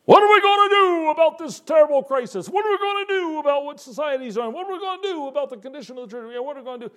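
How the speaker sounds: high, anxious fretting voice, fading away; worried churchgoer. Retold or imitated. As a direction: mimic a panicky congregant wringing hands. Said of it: "I've had people ask me".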